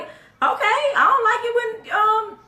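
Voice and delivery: high, laughing mock whine; mocking quote. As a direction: mimic the complaint in a high laughing voice. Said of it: "So we just like".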